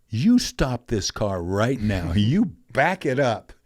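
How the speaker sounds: a retold mother snapping at her daughter to stop; stern scolding. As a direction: draw out each word like a furious mom.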